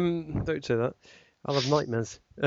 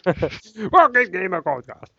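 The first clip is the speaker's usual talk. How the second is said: louder, slower, higher fake German welcome; loud mock German; 5 semitones higher, 5 dB louder, slower than usual. Host announcing the podcast, loud mock German. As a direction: boom it out in a hammed-up German accent. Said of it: "really loud".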